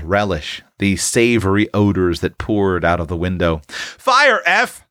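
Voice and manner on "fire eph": high, commanding call; firm cry. Acted. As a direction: order him to shoot. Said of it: "cried Tilly firmly".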